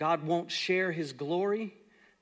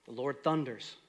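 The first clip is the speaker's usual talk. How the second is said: ordinary delivery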